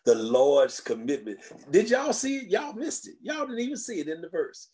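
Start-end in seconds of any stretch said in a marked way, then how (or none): 1.7-4.7 preaching excitement. Acted. Preacher teases the congregation.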